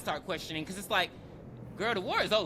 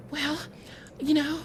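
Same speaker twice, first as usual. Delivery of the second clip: higher, hammed-up excuse from the wife; higher mock-innocent excuse; 6 semitones higher than usual. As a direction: play the guilty wife brushing it off, pitched up. Wife excusing herself to husband.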